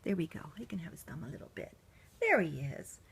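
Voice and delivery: soft unvoiced whisper. Real, not acted. Whispering while settling the doll.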